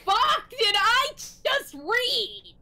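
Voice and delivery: slow, loud, disbelieving; incredulous outburst. Real, not acted. Reader shocked by the story, slow loud disbelief. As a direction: spell out disbelief at the awful story.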